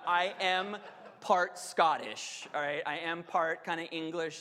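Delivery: usual speaking voice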